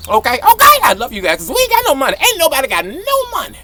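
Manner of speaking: high joking register; high comic voice. Pretend. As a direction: joke it out up high.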